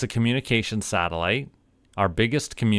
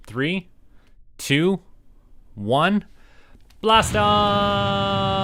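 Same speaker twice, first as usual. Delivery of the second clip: excited countdown; 10 semitones higher, much slower than usual. Acted. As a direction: count down with rising hype and yell the blast-off. Launch countdown to liftoff.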